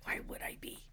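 whispered, very soft question; imitated low-volume reply; retold hushed reassurance. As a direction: whisper it gently back to a friend. Retold gentle reply to crying friend.

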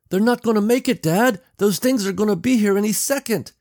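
put-on high, loud, panicked girl; tearful alarm. A narrator's reading. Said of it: "tears now in her eyes".

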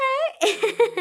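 high, loud burst of laughter; a real teary laugh; emotional laugh. Real, not acted. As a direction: laugh it off, overwhelmed. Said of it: "I'm getting emotional".

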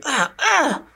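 high strained cries of a scuffle; grappling grunts. Acted. Game master as two villagers grappling on the ground, strained cries. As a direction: cry out as if wrestling someone. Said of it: "They both start grappling on the ground".

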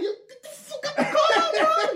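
high, laughing exasperated shout; exasperated yell. Retold or imitated. Narrator shouting at drunk friend in the Uber, laughing exasperation. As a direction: yell at him through laughter.

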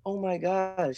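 lightly voiced sweet old lady, pitched high; a hint of a cute little old lady. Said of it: "this old lady comes up to me".

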